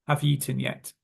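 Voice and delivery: quick "Have you eaten yet" like "hav-yeaten yet"; "you eaten" blended into one word; merged words. Shown as a demonstration. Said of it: "we would merge together you and eaten".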